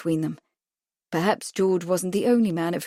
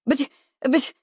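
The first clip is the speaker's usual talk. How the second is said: sputtering; 6 semitones higher than usual. A narrator's reading. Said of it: "Winston was sputtering".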